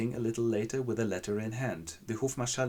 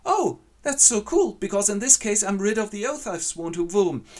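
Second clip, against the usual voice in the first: giddy delight; 11 semitones higher, 8 dB louder than usual. Acted. Dying Luise gushes happily to Ferdinand. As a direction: gush with crazed joy. Poisoned girl rejoices oddly.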